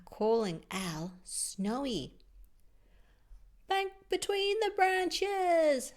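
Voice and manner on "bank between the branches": high, slow, loud instruction; Miss Bat teaching. Acted. Miss Bat teaching night flying, high, slow and loud. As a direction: call the flying drill slowly. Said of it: "said Miss Bat".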